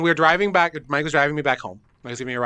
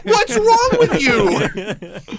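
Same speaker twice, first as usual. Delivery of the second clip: much higher, louder, very slow, laughing; exasperated disbelief; 6 dB louder, much slower than usual. Retold or imitated. Host scolding friend about audio, laughing exasperated squeal. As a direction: drag it out in laughing outrage. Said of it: "And I go".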